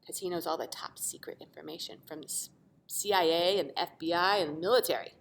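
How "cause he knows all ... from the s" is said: whispered aside, no voice; secret whisper. Acted. Reader confiding to the kids, whispered. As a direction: breathe it like a secret.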